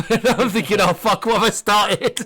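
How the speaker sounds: loud, high exclamation through laughter; laughing mock dismay. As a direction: crack up as you retell your regret. Laughing at child's repeated swearing.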